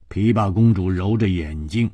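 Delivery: plain reading voice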